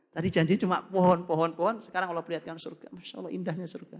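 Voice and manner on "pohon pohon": normal talking voice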